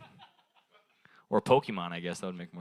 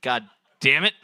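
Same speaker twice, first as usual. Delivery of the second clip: usual speaking voice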